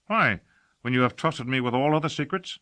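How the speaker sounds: loud, high indignant question. A narrator's reading. Casher protests, raised voice.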